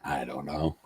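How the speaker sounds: a soft unvoiced whisper; whispered shy admission of not knowing. Real, not acted. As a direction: breathe it out, barely there. Unsure guest answering quiz question.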